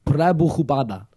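name said in a Mexican accent; "Sriya Prabhupada" in a slow Mexican accent. Shown as a demonstration. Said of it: "I did a Mexican accent".

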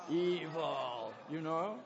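low, menacing evil; mock sinister villain voice on Evil. Shown as a demonstration. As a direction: growl the word Evil like a villain. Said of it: "say evil in an evil voice".

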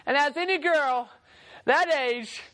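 usual speaking voice

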